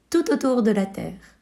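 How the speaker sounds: ordinary delivery, nothing marked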